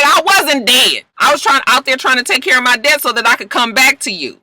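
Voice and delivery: high, mock-indignant retelling; indignant protest. Man back from paying his debt answers her, mock indignation. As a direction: protest it high and insistent, imitating him.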